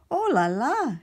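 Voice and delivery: high, loud "Oh la la" exclamation; loud, high French exclamation; cried out. Acted. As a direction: cry out in dismay as the hat flies. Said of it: "she cried".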